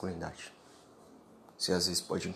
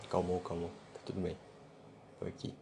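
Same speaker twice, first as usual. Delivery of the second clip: soft, fading comfort; soothing hush; 8 dB quieter than usual. Pretend. Comforting a crying sister at mother's wake, soft and soothing. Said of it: "demonstrar isso com um abraço".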